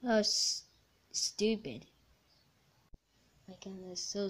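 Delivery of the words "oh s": ordinary talk, nothing marked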